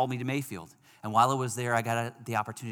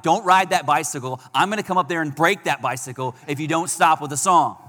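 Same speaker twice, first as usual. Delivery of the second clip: loud, frustrated threat at the upstairs neighbor; frustrated rant; 5 semitones higher, 10 dB louder than usual. Retold or imitated. Injured runner fumes at the neighbor's looping song, angry and loud. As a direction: yell it out like you're fed up. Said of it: "Super frustrated, super angry".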